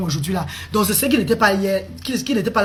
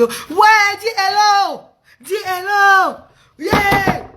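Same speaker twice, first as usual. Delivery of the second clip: much higher, loud impatient yelling; mock shouting parent; 12 semitones higher, 4 dB louder, much slower than usual. Mother mocking a parent who yells at the child. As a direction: imitate a parent screaming at the child. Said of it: "quand tu fais ça, tu le frustres".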